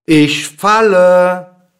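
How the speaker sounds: loud, high, long-drawn cry; calling out. A narrator's reading. Dwarf pretending to fall from the window, calling out slowly. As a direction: stretch the words out as a falling cry. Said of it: "rief der Zwerg".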